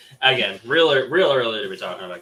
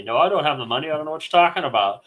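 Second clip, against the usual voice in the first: hustler's denial; 8 semitones higher, 6 dB louder than usual. Retold or imitated. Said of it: "trying to scam me, hustle me".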